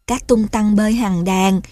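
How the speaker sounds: plain reading voice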